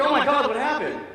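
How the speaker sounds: high, loud, alarmed exclamation; acted astonished outburst; shocked questioning. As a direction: blurt it out like a startled squadmate.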